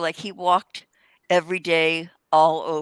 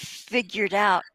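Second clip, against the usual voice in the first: ordinary delivery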